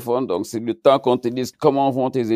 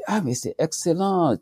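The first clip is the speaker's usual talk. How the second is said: higher-pitched delighted mother; retold happy exclamation, pitched up; imitated delight; 10 semitones higher, 4 dB quieter than usual. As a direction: act out a mother's thrilled approval. Voices mother's delighted reaction.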